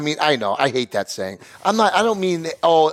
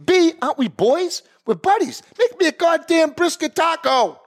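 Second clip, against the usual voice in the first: higher, mock-furious demand; mock rant; 10 semitones higher than usual. Mock venting at taco guy, higher mock-furious rant. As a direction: ham up the outrage.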